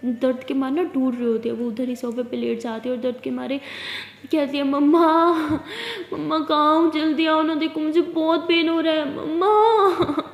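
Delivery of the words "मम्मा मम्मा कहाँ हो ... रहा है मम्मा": louder, tearful call, slowing to a sob; tearful cry to mom from the sofa. Acted. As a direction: wail for mom in pain. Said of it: "ऐसे कह के चिल्लाने लगती है".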